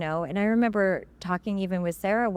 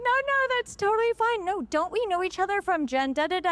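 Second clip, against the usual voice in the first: very high, breezy mock politeness; smooth it over sweetly; gracious reassurance; 11 semitones higher than usual. Gracious actress reassuring an embarrassed fan, high breezy voice. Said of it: "She's like".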